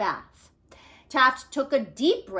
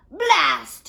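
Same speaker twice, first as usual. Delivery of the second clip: grumpy Taft; 5 semitones higher, 9 dB louder than usual. Acted. Taft cursing in the tub.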